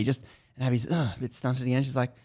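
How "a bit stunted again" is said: ordinary delivery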